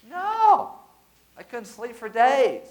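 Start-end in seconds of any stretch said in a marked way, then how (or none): 0.1-0.8 high, loud exclaimed no; emphatic no. Real, not acted. Preacher refuting his own joke, loud emphatic no. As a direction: shout down the idea. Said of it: "emphatic denial".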